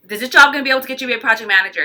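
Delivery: fast, pointed question fired off; rapid interrogation. Retold or imitated. Queen of Air quizzing Cancer on her job, fast. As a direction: fire the question off quickly. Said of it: "She'll be like".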